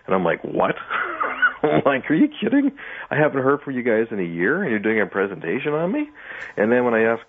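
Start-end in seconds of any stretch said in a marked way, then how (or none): 0.4-1.8 incredulous one-word question with a laugh after; mock-stunned "what"; retold disbelief. Witness retelling the news of a presentation on them, disbelieving. Retells her shocked reaction. 2.1-6.1 high-pitched, disbelieving questions; retold incredulity. Witness to the group presenting on them, incredulous. Retells astonishment at surprise presentation.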